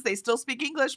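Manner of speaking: normal talking voice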